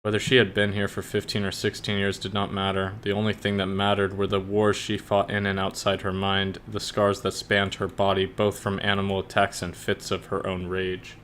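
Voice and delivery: quiet, even reading voice; soft, steady audiobook read; story narration. Acted. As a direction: narrate the bleak backstory calmly.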